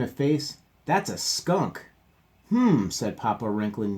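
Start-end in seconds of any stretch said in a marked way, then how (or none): 0.8-1.9 disgusted. Acted. Brother complains. 2.5-2.9 hmm. Acted. Papa sniffing.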